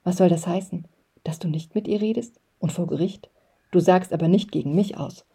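ordinary delivery, nothing marked

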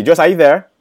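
ordinary delivery

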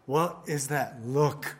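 usual speaking voice

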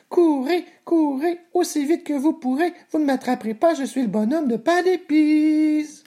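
very high, squeaky sing-song voice; taunting gingerbread man. Acted. Gingerbread man taunts his pursuers, high gleeful chant. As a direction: tease in a tiny high voice, stretching the ending.

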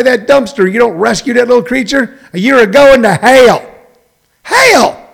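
fire-and-brimstone warning; preacher damnation. Acted. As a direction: preach it, rising to a howl on Hell. Preaching damnation for not rescuing.

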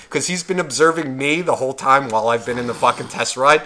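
ordinary talk, nothing marked

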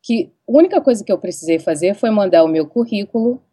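ordinary talk, nothing marked

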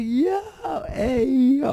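very high hollering cheer; crowd cheer. Retold or imitated. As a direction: yell across the room at your friends. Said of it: "We're like".

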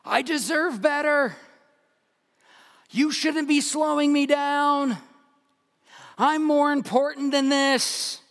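high, loud, slow entitled whine; entitled golfer. Retold or imitated. Pastor voicing the golfer's inner pride, loud high whine. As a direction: shout it like you deserve better. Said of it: "But what I heard was".

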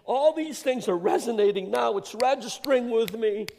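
high, loud preacher's build; full-voiced revival preaching; preaching at full force. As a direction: preach it with rising fervor.

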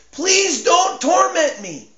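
high, slow, shouted plea; desperate begging shout. Acted. Demon begs Jesus not to torment it, shouting. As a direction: cry out begging for mercy.

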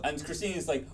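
ordinary talk, nothing marked

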